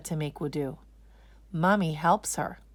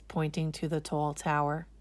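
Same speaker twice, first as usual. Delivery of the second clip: ordinary delivery, nothing marked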